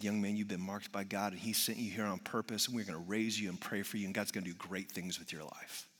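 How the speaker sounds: low, very soft, solemn teacher voice; hushed blessing. Retold or imitated. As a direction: quietly speak purpose over a child. Teacher tenderly blessing child.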